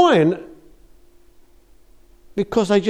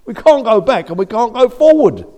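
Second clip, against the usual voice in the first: high, loud trapped wail; the Israelites crying out in despair, loud; 9 semitones higher, 10 dB louder than usual. Acted. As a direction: cry out stuck between two ways.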